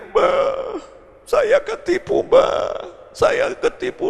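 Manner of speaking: very high, drawn-out mock weeping; imitated crying. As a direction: act a woman wailing to an elder. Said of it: "datang nangis-nangis". (pura-pura menangis meratap)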